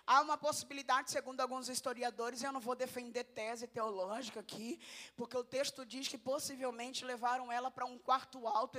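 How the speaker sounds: ordinary talk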